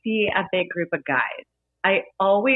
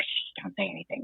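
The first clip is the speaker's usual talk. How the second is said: hushed, much softer aside; hushing whisper; 12 dB quieter than usual. Retold or imitated. Bartender hushing the friends so the victim won't hear. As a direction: shush him like a secret plot. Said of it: "'cause I'm like".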